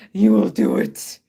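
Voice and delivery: rasping demon growl. Shown as a demonstration. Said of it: "demon voice like Kitty Dog does".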